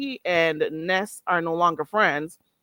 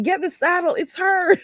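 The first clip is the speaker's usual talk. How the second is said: teasing chat voice; 10 semitones higher, 4 dB louder than usual. Retold or imitated. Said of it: "People are like".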